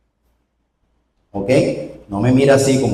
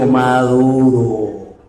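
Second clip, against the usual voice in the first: "maduro" drawn out very slowly; preacher sets the word for the congregation to repeat; lead the repeat; much slower than usual. Shown as a demonstration. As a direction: stretch "maduro" for the church to echo. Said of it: "Diga conmigo".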